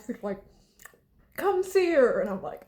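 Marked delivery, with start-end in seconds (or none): 1.4-2.2 high, insistent coaxing grandma; grandmother's urging. Retold or imitated. Grandma urging her granddaughter toward the casket, high and pressing. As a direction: coax her over to the casket, loud.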